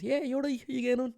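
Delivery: chirpy, high Irish greeting as if to a mate; Irish accent. Pretend. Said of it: "Or should I give them an Irish accent?".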